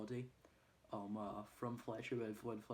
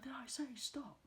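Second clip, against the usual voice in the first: scolding challenge. Retold or imitated. Coach to everyone who stopped, scolding. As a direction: imitate an indignant trainer scolding.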